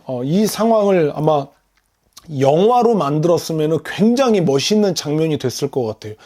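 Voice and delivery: usual speaking voice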